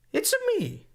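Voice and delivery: very high squeaky Mario impression; high cartoon Mario voice. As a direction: nail the plumber voice up high. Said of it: "There you go".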